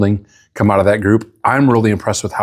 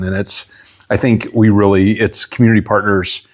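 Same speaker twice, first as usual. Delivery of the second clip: ordinary talk, nothing marked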